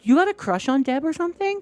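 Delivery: high, teasing question; joking. Retold or imitated. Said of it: "Like, joking".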